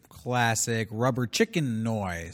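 slow, quiet deadpan. Acted. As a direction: drag it out flatly.

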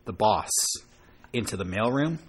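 mock mafia boss. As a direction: growl it low like a crime boss. Plays angry record-label boss.

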